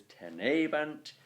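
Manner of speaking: "tenebant" weighted on "ne"; te-NE-bant, as it is pronounced; stress on middle syllable. Shown as a demonstration. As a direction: stretch the "ne" of "tenebant". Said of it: "The stress is therefore on the tene".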